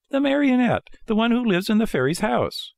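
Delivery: very high little puppet voice; high-pitched puppet question; Pinocchio. A narrator's reading. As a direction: ask as a small, eager marionette. Puppet explains himself.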